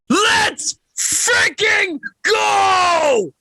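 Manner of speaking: drawn-out arena-style scream; hype shout. Acted. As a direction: roar it like a ring announcer firing up the crowd. Podcast host hypes the opening.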